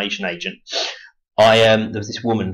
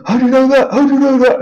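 high, loud, astonished squeal; shocked gasp; 8 dB louder than usual. Retold or imitated. Acquaintance caught out, shocked high squeal.

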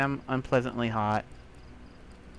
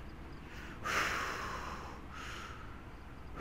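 long relieved sigh; 12 dB quieter than usual. Real, not acted. Relief after escape.

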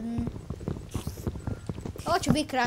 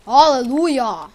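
mock hallelujah; 11 dB louder, slower than usual. Real, not acted. Survived the night.

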